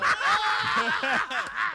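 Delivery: high, loud burst of laughter; loud laughing shriek. Real, not acted.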